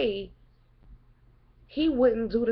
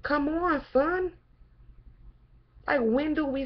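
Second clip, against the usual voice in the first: exasperated; 6 semitones higher than usual. Real, not acted. Scolding rhetorical exclamation.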